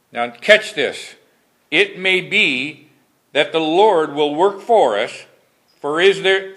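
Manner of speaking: solemn pulpit reading of scripture. Acted. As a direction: read the King James verses with solemn weight. Preacher reads scripture aloud.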